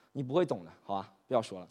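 low, soft brush-off; dejected, quiet and low; downcast. Acted. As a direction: sullenly give up on explaining. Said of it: "看上去心情不好嘛".

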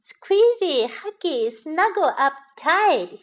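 put-on high, happy child voice; happy little bunny. A narrator's reading. Little bunny cuddling up to Mummy, happy high voice. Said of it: "he said happily".

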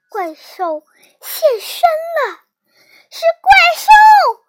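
loud cry rising to a high shriek; high, panicked character yell; screaming in alarm. A narrator's reading. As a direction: scream the warning, building. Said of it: "苏丹大叫".

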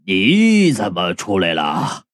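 put-on low, slow, slurring drunk voice; slurred drunk question. A narrator's reading. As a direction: mumble it drunk, words running together. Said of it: "口齿不清地问". (低沉缓慢、口齿不清的醉汉声音)

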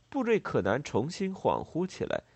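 ordinary delivery, nothing marked

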